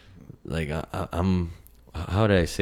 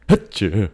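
sudden loud, high sneeze; real sneeze; 4 semitones higher, 6 dB louder than usual. Real, not acted. Host interrupted by a sneeze, real sneeze. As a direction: sneeze out loud mid-talk.

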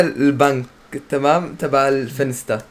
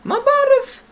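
squeaky high voice; slower than usual. Pretend. Playful high denial.

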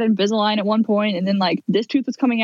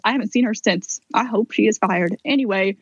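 normal talking voice

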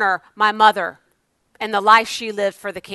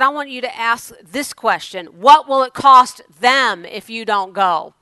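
slow, louder final question; God's voice, emphatic; 5 dB louder than usual. Retold or imitated. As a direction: press the question home slowly. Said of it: "He said".

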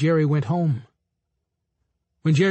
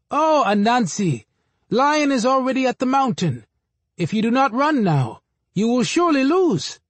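high fretting monkey; 8 semitones higher than usual. A narrator's reading. Monkey warns Anansi he will lose, high and urgent. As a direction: urge the spider to hurry, pitched up.

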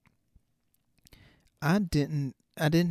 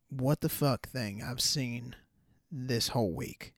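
normal talking voice

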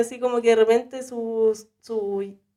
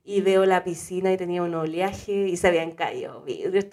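ordinary delivery

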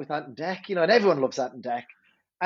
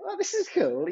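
put-on kids impressed; 10 semitones higher than usual. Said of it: "They went from".

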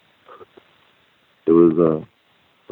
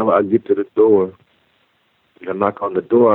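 ordinary delivery

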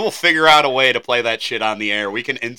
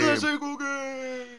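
high, slow, soft announcer; lightly hammed-up game show announcer; 14 semitones higher, 8 dB quieter, slower than usual. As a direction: sing out the show segment title. Announcing the segment title.

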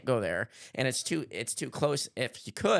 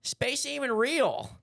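high, slow, mock-grand claim; mock dramatic; 14 semitones higher, slower than usual. Real, not acted. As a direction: announce it like a wild conspiracy. Conspiracy claim, mocking tone.

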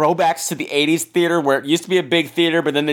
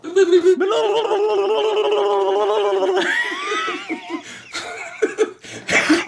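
evil cackle. Acted. Spooky laugh during trailer bit.

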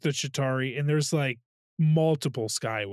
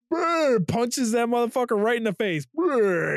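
high, loud and thrilled; excited punch sound effect; 9 semitones higher, 5 dB louder than usual. Retold or imitated. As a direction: act out the big punch. Acting out giant punch.

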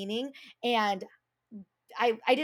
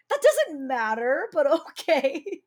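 laughing; 6 semitones higher, 6 dB louder, slower than usual. Retold or imitated. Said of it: "I stood there and I go".

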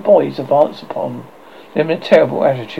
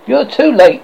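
high, louder boast; proud cry; 9 semitones higher, 7 dB louder than usual. A narrator's reading. Tootles greets the arriving boys over his victim, crying proudly. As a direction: crow it triumphantly, pitch lifted. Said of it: "he cried proudly".

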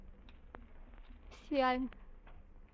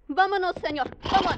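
loud impatient command; 8 semitones higher, 8 dB louder than usual. Acted. Impatient to leave.

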